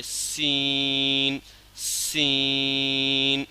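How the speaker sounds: سین drawn out very slow with a hissing whistle; whistling seen. Shown as a demonstration. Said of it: "سیٹی کی آواز نکالنا ہے اور کھینچنا ہے".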